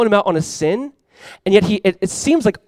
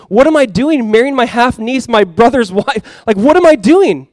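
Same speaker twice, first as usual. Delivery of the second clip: raised voice, a touch appalled at oneself; mild self-reproach; 5 semitones higher, 5 dB louder, slower than usual. Acted. Imagined Herod repenting over marrying his brother's wife, mild dismay. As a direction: act out a dawning realization of wrongdoing.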